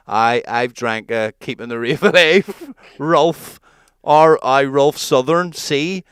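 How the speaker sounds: put-on slurred, slow and loud drunk voice; mate leaving a slurred drunken voice message. Imitating his drunk mate's voicemail, slow and slurred. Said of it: "understand that I was drunk".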